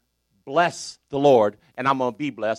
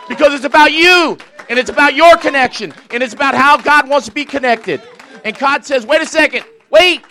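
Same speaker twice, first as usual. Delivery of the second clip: higher and louder, fired-up sermon; full-force preaching; 11 semitones higher, 9 dB louder than usual. Acted. Preacher lifting the church, fired-up preaching. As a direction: preach it out with rising fire.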